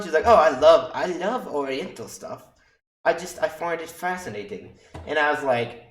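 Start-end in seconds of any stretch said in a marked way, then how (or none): 0.3-4.8 higher-pitched imitation of a British accent; attempted British accent. Imitating an upbeat patient gushing about Oriental stuff, attempted British accent. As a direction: put on an amateur British accent. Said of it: "speaking in a British accent".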